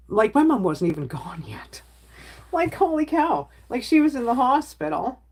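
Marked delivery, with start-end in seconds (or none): 0.1-1.8 breathy whisper with a laugh in it; half-laughing, let it slip in a hushed whisper. Real, not acted. To her chat about the hospital, a laughing whisper. Confiding how she didn't cry.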